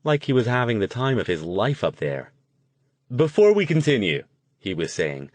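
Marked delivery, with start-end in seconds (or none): 3.1-4.2 high, loud stage host; full of enthusiasm. A narrator's reading. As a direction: address the audience with bright-eyed zest. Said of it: "his voice was pleasant and full of enthusiasm".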